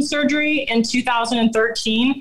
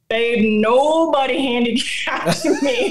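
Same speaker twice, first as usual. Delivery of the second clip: slow, raised retort on "handicapped"; mock-furious comeback, drawn out; retold indignation; 3 semitones higher, slower than usual. Wife retorting to husband who handicapped her, indignant. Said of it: "I was just furious".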